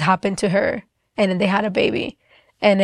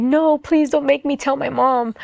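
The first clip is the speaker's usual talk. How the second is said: higher, mildly tearful retold begging; tearful plea; 5 semitones higher than usual. Girl begging God not to tell mom, mildly tearful. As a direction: plead not to tell, a touch of tears. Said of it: "And I would just cry".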